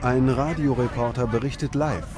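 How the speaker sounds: ordinary talk, nothing marked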